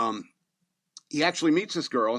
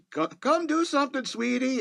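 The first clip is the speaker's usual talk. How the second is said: high mock mom call; a mother calling her son up from below; mom calling upstairs; 13 semitones higher than usual. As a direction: call up the stairs as the sweet mom. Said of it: "his mom shouts up".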